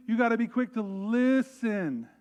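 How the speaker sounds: slow, emphatic, each word landed; emphatic preaching. Real, not acted. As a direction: drive home the key line. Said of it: "preaching point".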